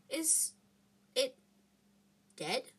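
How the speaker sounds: soft question broken by long pauses; hushed, hesitant word by word; timid, halting. A narrator's reading. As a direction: ask it haltingly, in shock. Shaken Hermione speaks first after fight.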